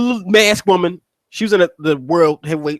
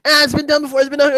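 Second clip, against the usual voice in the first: high-pitched dismissive naysayer; mocking high-voiced heckle; mock objection; 7 semitones higher, faster than usual. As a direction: imitate a viewer brushing it off, pitch up. Voicing dismissive objecting viewers.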